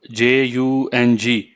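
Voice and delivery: each letter spaced out slowly for a servant; spelled out slowly. Acted. Imran slowly spells the name for his servant. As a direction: spell J U N G slowly so he can memorize it. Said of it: "اچھا، یاد کر لو".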